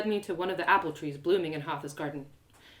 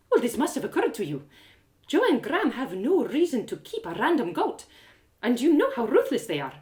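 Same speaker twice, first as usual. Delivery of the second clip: Hatha's voice; 5 semitones higher, 4 dB louder than usual. A narrator's reading. Hatha's voice in narration.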